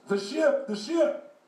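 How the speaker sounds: put-on high-pitched old man calling out. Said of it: "this old guy, probably seventy years old".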